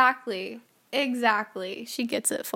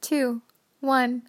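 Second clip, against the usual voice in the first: ordinary talk, nothing marked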